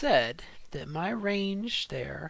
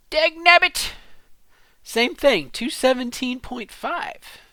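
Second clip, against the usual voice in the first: frustrated outburst; 5 semitones higher, 11 dB louder than usual. Real, not acted. Frustrated at the same pitch reading.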